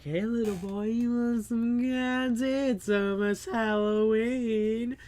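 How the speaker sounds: mock creepy candy man; creepy stranger. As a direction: lure the kid, drawn out. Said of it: "He walked up's like".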